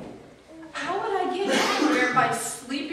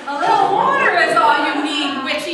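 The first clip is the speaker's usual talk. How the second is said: loud threat; 4 semitones higher, 8 dB louder, faster than usual. Acted. Threatening witch with water.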